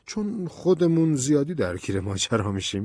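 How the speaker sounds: high-pitched, placating colonel's voice; amused colonel. A narrator's reading. Colonel passing a threat off as friendly advice, amused. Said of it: "سرهنگ خندید و گفت".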